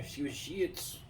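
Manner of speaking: slow, drawn-out Carioca "Jiu-Jitsu"; Carioca accent. Retold or imitated. Teasing how cariocas say it, mock Carioca accent. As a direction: imitate a Rio accent, stretching the word. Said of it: "principalmente os, os cariocas".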